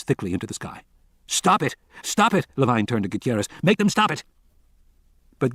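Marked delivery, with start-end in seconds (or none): 1.3-2.5 high, desperate cries; shouting. A narrator's reading. Men burning the precious carcass. 3.6-4.2 high, urgent demand; shouting. A narrator's reading. Urging Gutierrez to intervene.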